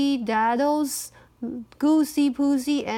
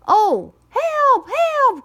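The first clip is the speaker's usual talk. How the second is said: high, louder panicked rooster cry; frightened cry for help; 12 semitones higher, 6 dB louder, much slower than usual. Acted. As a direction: scream for help as the fox pounces. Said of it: "cried Cocky Locky".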